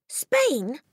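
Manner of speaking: put-on high-pitched childish disbelief; shocked cry. A narrator's reading. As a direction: echo it in dismay, pitched high. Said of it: "Matilda cried".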